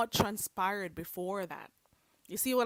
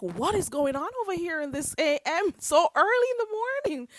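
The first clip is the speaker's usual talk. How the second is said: higher, louder rising exclaim; loud mock disbelief; 8 semitones higher, 6 dB louder than usual. To viewers about early drama, loud mock disbelief. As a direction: throw your hands up in disbelief.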